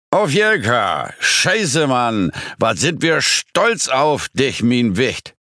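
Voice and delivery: put-on drawn-out, high-pitched drunken toast; tipsy giant. A narrator's reading. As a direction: toast her proudly, drunk and slow. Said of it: "deutlich angetrunkener Hühne". (angetrunkener, gedehnter Trinkspruch)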